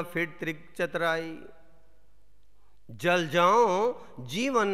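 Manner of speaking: measured Gurbani verse reading with long pauses; solemn, deliberate recital of sacred couplets; scripture recitation. Acted. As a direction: declaim each phrase, letting the line endings fall. Recites scripture after quicker commentary.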